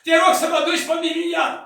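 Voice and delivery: strigăt tare și înalt de rugăciune disperată; urlă rugăciunea, cu rar la început; rugă strigată. Retold or imitated. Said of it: "Plângea și urla și zicea". (strigăt disperat de rugăciune)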